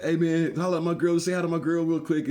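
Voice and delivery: lightly voiced friend urging; imitating Jay.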